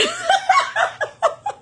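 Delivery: high, loud cackle; shrieking real laughter; helpless laughing fit. Real, not acted. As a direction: burst out laughing at your own bad luck. Cracks up at her own predicament.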